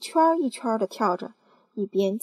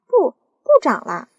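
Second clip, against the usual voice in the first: arguing aunt; 7 semitones higher, 11 dB louder than usual. Acted. Aunts bickering over peach.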